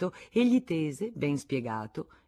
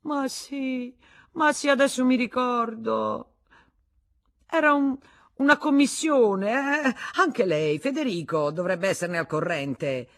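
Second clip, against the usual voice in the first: high, loud, eager excuse; bluster; 10 semitones higher, 8 dB louder than usual. Acted. Husband dragging the guest into his lie, blustering. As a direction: grab at an alibi.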